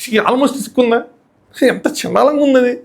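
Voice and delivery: high, slow, drawn-out disgust. Real, not acted. Reviewer reacting to a contestant with disgust. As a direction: sneer at how ugly it was.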